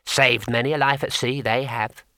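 a small painted imp, unhurried; tiny imp. Acted. Imp settling the matter for the wizard, small creature voice.

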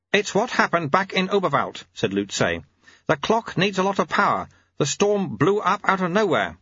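put-on old master explaining. A narrator's reading. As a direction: play a mentor working out the problem.

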